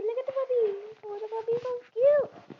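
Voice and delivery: high, loud cooing over a puppy; cutesy gushing. Real, not acted. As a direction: squeal with delight at it.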